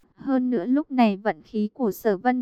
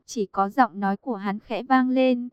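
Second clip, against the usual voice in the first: plain reading voice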